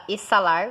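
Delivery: "exalar" said as e-ssa-LAR; "exalar" with an unvoiced S sound; wrong S pronunciation. Shown as a demonstration. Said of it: "você não fala exalar".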